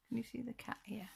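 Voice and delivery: soft unvoiced whisper. Real, not acted. Showing the viewer a hidden cat, whispered. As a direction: whisper it like a secret.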